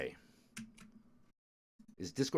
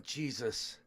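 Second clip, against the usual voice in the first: ordinary delivery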